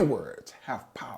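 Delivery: a soft unvoiced whisper on the words; murmure, presque chuchoté. Real, not acted. Stressing power of words.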